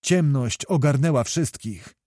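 ordinary delivery, nothing marked

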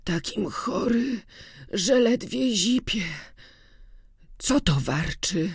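put-on weak sick old man. A narrator's reading. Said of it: "słabym, schorzałym głosem".

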